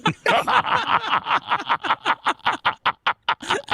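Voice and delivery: real laughter that soars up; high cackling laugh. Real, not acted. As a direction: cracks up hard at his own joke. Cracking up at his own bit.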